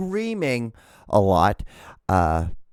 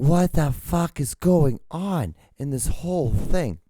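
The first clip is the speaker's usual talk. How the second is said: high, slow, stretched-out disbelief; slow incredulous retelling, pitched up; exasperated bewilderment; 5 semitones higher, slower than usual. Retold or imitated. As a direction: draw out each word as if utterly baffled.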